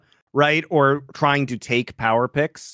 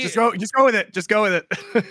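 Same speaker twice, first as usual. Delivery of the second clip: laughing; 9 semitones higher than usual. Real, not acted. Laughing, repeating a phrase.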